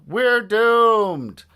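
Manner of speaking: high, loud doom-laden yell; despairing cry. A narrator's reading. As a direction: wail it as all is lost. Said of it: "cried Harold".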